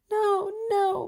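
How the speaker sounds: high, loud anguished cry; sobbing. A narrator's reading. Belle crying over the dying beast, high and loud. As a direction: deny it through tears. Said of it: "sobbing as she kissed".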